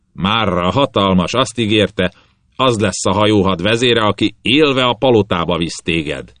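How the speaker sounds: pirate captain sneering at his captive; menacing sea captain. Acted. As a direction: lean over the prisoner and gloat.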